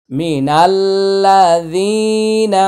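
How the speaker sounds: very slow, high melodic tilawat; Quran recitation. Shown as a demonstration. Teacher reciting the verse for students, slow and melodic. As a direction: chant each phrase with long held notes.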